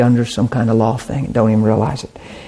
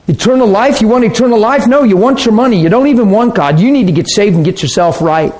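higher, incredulous questions turning to reproach; mockingly berate, in a higher insistent voice; scornful scolding rebuke; 6 semitones higher than usual. Preacher mimics believers judging the rich man, scornful scolding. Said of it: "That's how we could treat people".

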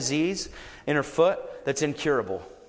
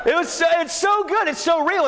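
joyful shout; 13 semitones higher, 4 dB louder than usual. Real, not acted. Preacher overjoyed at healing.

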